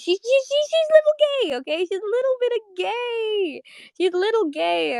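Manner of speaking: very high, teasing sing-out; gleeful squeal. Real, not acted. Vlogger gushing over Marceline's crush, gleeful high squeal. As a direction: squeal it playfully, stretching the words.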